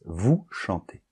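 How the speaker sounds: slow, soft Vous chantez with a silent s; quiet teacher example without liaison; no liaison demo. Shown as a demonstration. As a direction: keep vous and chantez apart, no z sound. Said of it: "on n'entend pas la lettre S".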